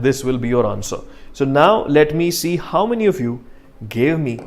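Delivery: ordinary talk, nothing marked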